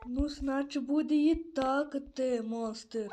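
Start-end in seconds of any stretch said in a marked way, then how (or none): none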